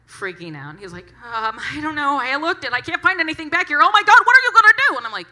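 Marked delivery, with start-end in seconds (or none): 1.2-5.0 high, loud, rising into frantic shrieks; a scared kid losing it, faster and faster; imitating a freaked-out clerk, from nervous to hysterical; panicking teen.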